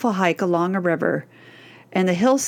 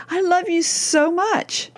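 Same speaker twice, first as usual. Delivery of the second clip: higher, slow and gushing; sweet daughter voice; 8 semitones higher, slower than usual. Retold or imitated. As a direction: coo it like a teen making up with mom. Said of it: "an hour later she'll say".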